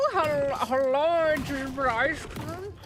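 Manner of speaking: put-on drawn out like baby talk; cutesy pet talk. Teasing pet about ice cream.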